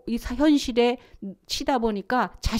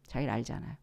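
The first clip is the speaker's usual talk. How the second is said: softer echo; 9 semitones lower, 10 dB quieter, faster than usual. Shown as a demonstration.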